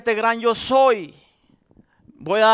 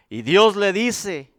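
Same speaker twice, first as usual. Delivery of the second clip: ordinary talk, nothing marked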